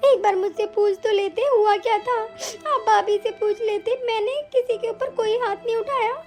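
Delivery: sobbing between phrases; tearful pleading. Acted. Wife defending herself to her accusing husband, crying. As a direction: explain yourself through hurt sobs. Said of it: "अरे ये ज्यादा नाटक तो तुम अभी करोगी".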